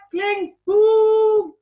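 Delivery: startling loud boom; loud crash sounds. A narrator's reading. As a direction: bang it out like a crash. Sound effects in story.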